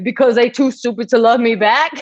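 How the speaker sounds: high, slurred dopey reading; dumb guy voice. Retold or imitated. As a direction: read it like a clueless lovesick guy. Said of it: "That's how I heard it".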